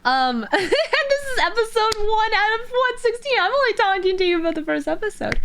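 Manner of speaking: high, squeaky laughing talk. Real, not acted. Streamer admits this is only episode one, laughing. Laughs at own long summary.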